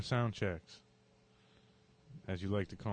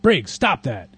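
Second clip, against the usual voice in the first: louder command to the dog; yelled command; 3 semitones higher, 17 dB louder, much slower than usual. Acted. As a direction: shout the dog off. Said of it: "they yelled".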